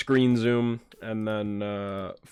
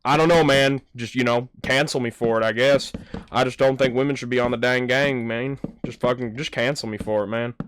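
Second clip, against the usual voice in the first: louder mock-defiant gamer dude; imitated Fortnite gamer; 4 semitones higher, 6 dB louder than usual. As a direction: mimic a stubborn guy insisting over game chat. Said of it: "He's like".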